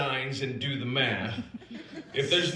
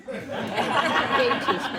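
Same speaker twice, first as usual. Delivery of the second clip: excited echo; 9 semitones higher than usual. Acted. Gleeful repeat of Playboy paging.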